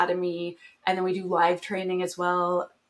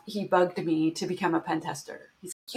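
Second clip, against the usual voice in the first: ordinary talk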